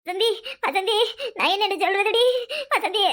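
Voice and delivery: tearful wail breaking into sobs; crying. Acted. Distressed woman turns to friend Vasanthi, crying. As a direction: sob out your friend's name helplessly. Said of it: "காலையில இப்படி அழுவுற".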